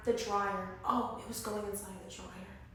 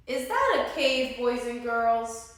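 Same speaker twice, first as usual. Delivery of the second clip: louder, drawn-out question to the audience; 8 dB louder, slower than usual. Real, not acted. Reader quizzes the children.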